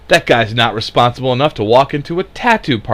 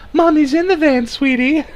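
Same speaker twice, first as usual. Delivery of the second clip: much higher, sugary lure; sweet coaxing stranger. Pretend. Stranger coaxing child into the van, sugary high. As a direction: coax the kid with fake sweetness.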